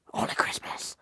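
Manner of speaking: hushed, breathy aside; mock whisper. Mocking a Christmas zealot, whispered aside. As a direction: whisper it like a secret creed.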